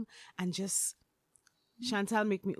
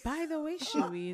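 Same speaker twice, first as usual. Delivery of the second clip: light valley girl impression, higher opening; 6 semitones higher, 5 dB quieter than usual. Repeating Chantal's line to Sherwin in valley girl voice. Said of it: "In her most valley girl voice".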